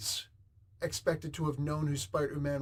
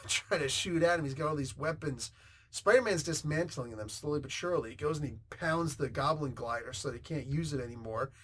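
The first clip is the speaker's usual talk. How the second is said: normal talking voice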